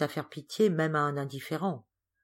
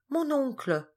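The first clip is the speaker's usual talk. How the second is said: higher, searching question on mon oncle; puzzled repeat; 10 semitones higher, slower than usual. Acted. Geneviève searching her memory, mild puzzled question. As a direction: ask mon oncle slowly, trying to remember. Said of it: "répéta-t-elle en interrogeant ses souvenirs".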